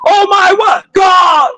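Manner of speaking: high shouted cry of a scared crowd; imitating terrified screamers calling on God; mock panicked hollering. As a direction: holler it like people fleeing a storm. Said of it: "they be hollering and screaming".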